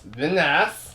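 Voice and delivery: lightly voiced Borat-style drawn-out catchphrase; a hint of suave comic foreign voice. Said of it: "I love when you do it".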